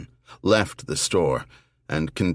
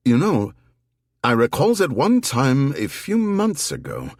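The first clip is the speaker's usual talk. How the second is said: lightly voiced older shopkeeper, higher pitched; gentle shopkeeper remembering, voice pitched higher; shop owner Johannes; 6 semitones higher than usual. A narrator's reading. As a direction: give a hint of the helpful, thoughtful shopkeeper. Johannes recalls Anna visiting.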